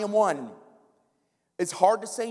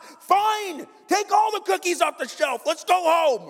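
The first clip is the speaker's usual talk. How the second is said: high, louder fed-up outburst; exasperated surrender; 10 semitones higher, 4 dB louder than usual. Acted. Parent caving to the kid's store tantrum, exasperated. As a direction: give in loudly, at wit's end.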